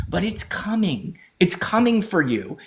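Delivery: ordinary talk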